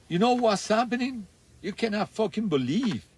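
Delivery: rushing out of the sauna to tell Tony about the nuclear offer, imitated excited high voice; excited retold outburst. Tarzan bursting out of the sauna.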